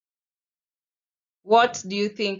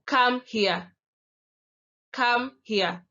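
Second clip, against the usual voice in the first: command; 4 semitones higher, 3 dB louder, slower than usual. Shown as a demonstration. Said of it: "that is a command".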